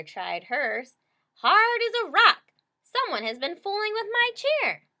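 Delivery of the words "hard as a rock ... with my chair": high, loud offended mother bear; mama bear finding her hard chair; indignant mama bear. A narrator's reading. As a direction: huff it like an outraged mama bear.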